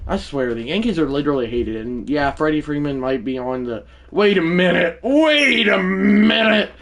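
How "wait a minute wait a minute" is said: loud, high double take, building; astonished, stretched shout; shocked. Real, not acted. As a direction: cry out in disbelief, second time bigger and slower. Shocked by a player on waivers.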